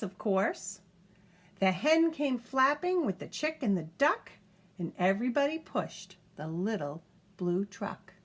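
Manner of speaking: lively picture-book reading; reading to a child. A narrator's reading. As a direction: read it aloud to a toddler, bouncy and clear. Reads picture book aloud.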